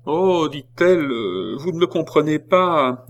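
high, loud pleading voice; pleading prisoner. Acted. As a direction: plead to win them back. Jeanne pleads to keep sympathy.

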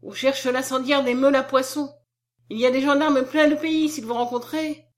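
anxious high-pitched alarm; alarmed warning. A narrator's reading. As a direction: warn him of danger, voice up. Alarmed warning about the gendarmes.

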